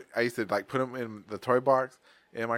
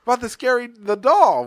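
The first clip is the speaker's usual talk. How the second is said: high, louder mock explanation; mocking family voice; 10 semitones higher, 7 dB louder than usual. Family explaining the fridge prank, high and loud. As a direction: spell it out like the pranksters. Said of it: "I'm like".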